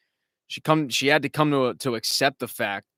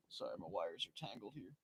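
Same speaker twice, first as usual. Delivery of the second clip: much softer off-mic apology; muttered aside; 5 semitones lower, 24 dB quieter than usual. Real, not acted. Untangling wires mid-podcast, mumbling a soft aside.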